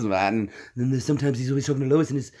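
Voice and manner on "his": usual speaking voice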